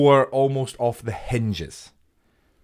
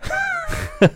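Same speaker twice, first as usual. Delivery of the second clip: high shrieking laugh. Real, not acted. Co-host shrieking with laughter at the joke.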